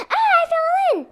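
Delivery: high wail of falling in; hammed-up high-pitched splash cry; mock fall cry. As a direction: yelp as you tumble into water. Twin falls in lake.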